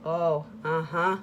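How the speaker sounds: soft bored "ah, oh"; lightly mimicked dismissive grunts. Therapist reacting to her assault, soft bored grunt. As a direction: mimic a therapist barely responding. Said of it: "it just".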